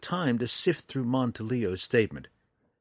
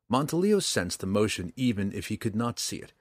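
ordinary delivery

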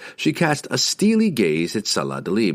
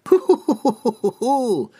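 high nervous giggle; 3 dB louder than usual. A narrator's reading. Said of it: "Salah Dalib giggled nervously".

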